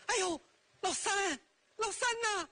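high sing-song call across the yard; 嫂子拉长声喊人; sister-in-law calling out. Acted. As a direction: pitch it high and sweet to lure him out. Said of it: "一进院儿就喊".

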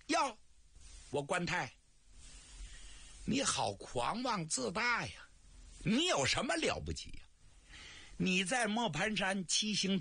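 put-on high-pitched scolding old woman; shrill old matchmaker. The matchmaker scolds Guan Tai for his arrogance, high scolding old woman. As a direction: nag him down from a height. 这婆子一听也不愿意了.

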